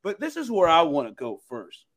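usual speaking voice

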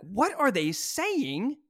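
high, drawn-out puzzled exclamation; exaggerated baffled question; mock bafflement. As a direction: re-enact total confusion, slowly. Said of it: "I was like".

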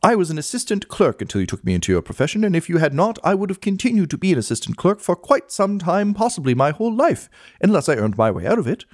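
puffed-up clerk. Acted. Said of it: "puffs himself up a little bit".

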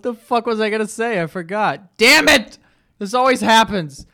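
loud, high exasperated cry; frustrated outburst. Real, not acted. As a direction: snap at yourself for forgetting. Frustrated at forgetting his point.

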